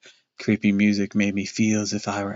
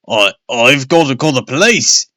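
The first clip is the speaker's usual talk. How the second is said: louder, flustered sputter; 3 semitones higher, 7 dB louder than usual. Acted. Father sputtering to the family after the blast. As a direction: trip over the words in panic. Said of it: "Dad sputtered".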